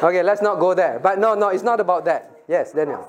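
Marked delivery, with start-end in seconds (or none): none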